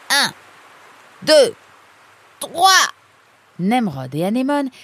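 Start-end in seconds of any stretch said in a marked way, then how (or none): 0.1-2.9 loud, high count with pauses; effortful count-in. Acted. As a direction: count your partner in for the big pull. Counting for a joint pull.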